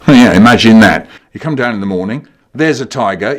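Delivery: ordinary talk, nothing marked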